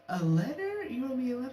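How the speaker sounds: can't believe what you heard; incredulous. Retold or imitated. Told by phone he wrote her a letter, incredulous high echo.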